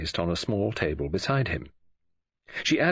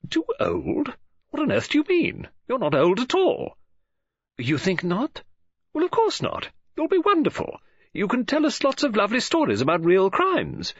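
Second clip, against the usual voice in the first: bright, higher-pitched woman's voice; Mrs Oliver; 13 semitones higher, 4 dB louder than usual. A narrator's reading. Novelist greeting Poirot on the phone, bright high voice. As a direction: play the chatty lady novelist, lifted and eager.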